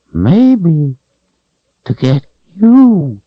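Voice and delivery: high whining creature voice; creature whine. A narrator's reading. Creature whining to Richard about the Calthrop. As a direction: whine it out, cringing.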